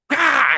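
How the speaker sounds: high sharp "Ah" like a striking snake; shrill imitated snake strike; snake lunging shriek. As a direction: mimic the snake's attack with a yelp. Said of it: "it'd just be like".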